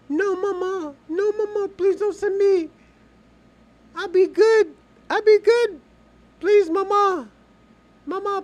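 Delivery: put-on high, loud whiny kid pleading with mama; mock begging child. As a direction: play a terrified kid begging not to be sent away. Child begging parent in story.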